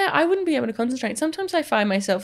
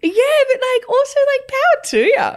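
mock cheering; 14 semitones higher, 3 dB louder than usual. Mock supportive cheer at confession.

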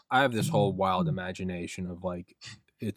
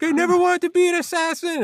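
loud, very high comic wail; mock crying wail; 5 dB louder than usual. Assassin breaking down before the podcaster, mock crying. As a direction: wail it like a hammed-up sobber. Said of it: "they're crying and they're like".

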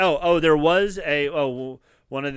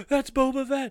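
high-pitched thrilled fan reaction; gasp, then squeal the recognition in imitation; excited gasp; 11 semitones higher than usual. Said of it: "So we were so excited for that".

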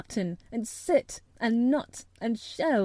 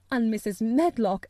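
ordinary delivery

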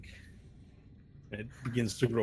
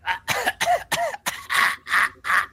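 very high, loud strangled gagging; a hammed-up monster choking on its own tongue; disgusting creature gag; 8 dB louder than usual. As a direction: choke and retch like a monster. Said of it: "It makes this disgusting sound".

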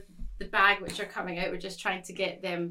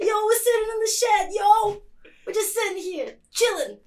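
much higher, louder, fast generic American bro; hammed-up American bro showing off; bombastic American; 13 semitones higher, 9 dB louder than usual. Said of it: "I have to be more bombastic when I'm American".

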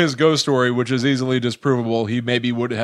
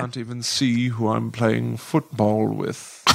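put-on slow, soft British accent; 3 semitones lower, 4 dB quieter, slower than usual. A Whitcomb player tells Mister Potter in a British accent. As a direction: drawl it like a prim Brit. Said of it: "That's British".

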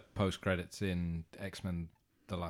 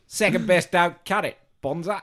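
high, loud mock Australian; Aussie Wolverine; 9 semitones higher, 9 dB louder than usual. Capping the bit with Australian slang in a loud mock voice. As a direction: toss off Aussie slang in a cartoon voice.

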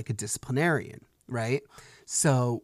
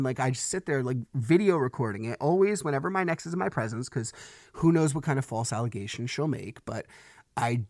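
usual speaking voice

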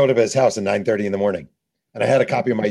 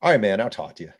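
J R Ryder giving in; 6 semitones higher than usual. Retold or imitated. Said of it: "He's like".

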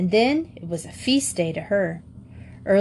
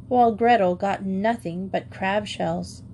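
ordinary delivery

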